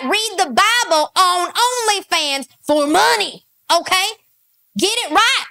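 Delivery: sarcastic mock tirade; mock outraged rant. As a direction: play a preachy outrage at Andrew.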